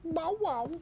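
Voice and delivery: high yappy bark; dog barking. Pretend. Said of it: "barking imitation".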